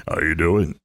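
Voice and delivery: low-pitched imitation of a big athlete's greeting; deep, imitated athlete voice; Johnny Roseboro impression. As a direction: drop your voice low to mimic a big ballplayer. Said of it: "And that's how he talked".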